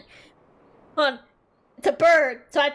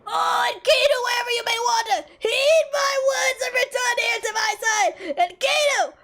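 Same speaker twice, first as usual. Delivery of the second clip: theatrical yelling; 10 semitones higher than usual. Acted. Warrior calling to his far-off companion, melodramatic yelling. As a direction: yell for a lost companion, stretching the last name. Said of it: "Why are you yelling?".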